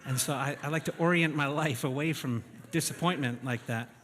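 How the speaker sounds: normal talking voice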